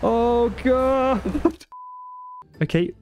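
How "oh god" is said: high startled oh god; shocked laugh. Real, not acted. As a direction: yelp in real surprise, half laughing. Drowned by water, laughing.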